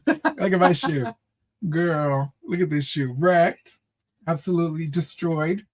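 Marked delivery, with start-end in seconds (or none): none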